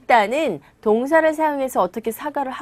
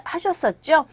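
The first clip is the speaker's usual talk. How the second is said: normal talking voice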